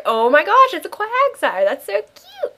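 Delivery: high, gushing squeal building up; gushing over cute Pokemon. Retold or imitated. Player gushing at a cute Quagsire, high squeal. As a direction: coo at it like a cute pet. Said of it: "I'm like".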